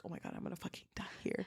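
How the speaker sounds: very fast, barely audible whisper; panicked whisper. Retold or imitated. Woman cornered in the dark, whispering in dread. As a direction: whisper it in a rush, acting scared. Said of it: "very quietly going towards her".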